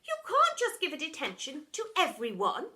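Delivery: secretary protesting to the deputy head. Acted. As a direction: cry out against the madness.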